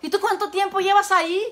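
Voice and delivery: a hint of a curious parrot calling out; the punished parrot calling up to the cross. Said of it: "y dice".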